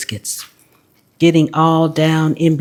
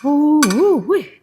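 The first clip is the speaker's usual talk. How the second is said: hot food exclamation; 8 semitones higher than usual. Real, not acted. Biting the hot biscuit.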